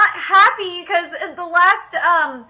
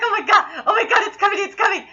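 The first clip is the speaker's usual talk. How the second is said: retold giddy anticipation, higher pitched; excited anticipation; 5 semitones higher, faster than usual. Recalling the wait for the makeup scene, excited. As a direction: replay your eager wait for it. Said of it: "I was excited initially".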